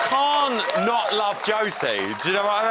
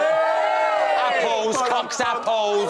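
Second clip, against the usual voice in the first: mock correction; 7 semitones higher, 4 dB louder, much slower than usual. Host correcting rude joke.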